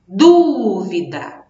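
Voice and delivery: dúvida with a long, punched DÚ; slow demo of dúvida, DÚ strongest; stress on first syllable. Shown as a demonstration. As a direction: hold the DÚ, let vida drop away. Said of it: "a sílaba mais forte tá aqui".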